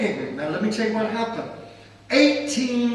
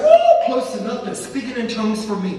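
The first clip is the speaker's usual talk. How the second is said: loud, high whoop breaking into laughter; thrilled, laughing outburst; retold elation; 5 semitones higher, 8 dB louder than usual. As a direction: whoop with delight at the news. Retold delight at free legal help.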